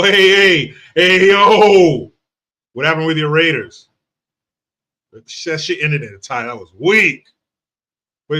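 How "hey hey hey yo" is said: loud, high hyped-up hey; excited greeting. Real, not acted. Host loudly hyping up Diesel in the chat. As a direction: shout out the greeting with energy.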